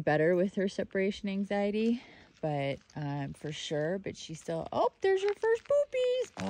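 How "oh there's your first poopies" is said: sudden high sing-song praise for the puppy. Real, not acted. Owner cooing at her puppy, high baby talk. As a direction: coo it at the dog in a high voice.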